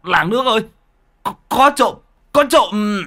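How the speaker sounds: high, stammered alarm call; acted high-pitched cry for help. A narrator's reading. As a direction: raise the village with a thief alarm. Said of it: "thì kêu lên".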